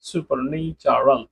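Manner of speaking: Supernatural said slowly and clearly; one slow careful model take. Shown as a demonstration. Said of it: "আপনি তিন ভাগ করতে পারেন". (ধীরে স্পষ্ট উচ্চারণ)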